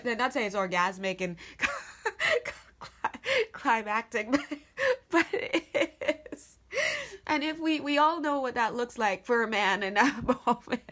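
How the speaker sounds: talking through real giggles; helpless laughter. Real, not acted. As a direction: voice climbs as laughter breaks up the words. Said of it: "'cause I'm laughing".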